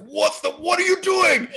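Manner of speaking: high, loud, imitated outburst; retold outraged scream. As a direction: yell it like an outraged date. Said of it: "she stands up and screams".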